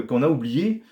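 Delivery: ordinary talk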